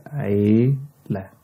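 عيلة slowly, long first vowel, then the last syllable; drawn-out syllables. Shown as a demonstration. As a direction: stretch عيلة out for learners. Teacher breaks word into syllables.

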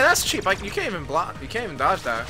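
ordinary talk, nothing marked

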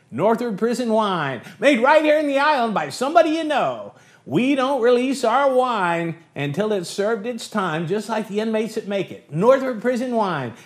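high, bright radio announcer pitch; peppy old-time ad read; chipper 1950s ad. Acted. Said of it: "I gotta go on the air".

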